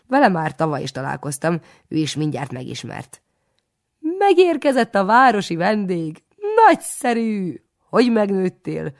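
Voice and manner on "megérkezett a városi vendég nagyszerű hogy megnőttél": high, loud, far booming welcome; hearty greeting. A narrator's reading. Jolly priest welcomes the city guest, high and loud. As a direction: greet him with big jovial delight.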